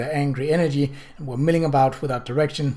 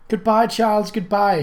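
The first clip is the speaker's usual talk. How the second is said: raised, higher farewell called across the water; crying out goodbye; 8 semitones higher, 5 dB louder than usual. Acted. Kate's friend calls goodbye to the gondola, raised and higher. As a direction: call goodbye to the departing balloon. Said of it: "she cried".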